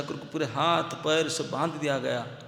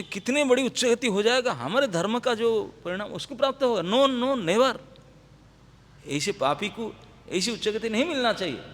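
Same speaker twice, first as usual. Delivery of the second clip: higher and louder, hammering no no never; retold official outrage, raised voice; loud indignant refusal; 5 semitones higher, 5 dB louder than usual. Qazis refusing burial to a sinner, in loud indignant refusal.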